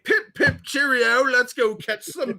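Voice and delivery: loud, high, comic British toff; hammed-up British accent. Joking how he'd ruin a Western, hammed-up British accent. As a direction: cry it out in an exaggerated British accent. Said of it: "suppress his British accent".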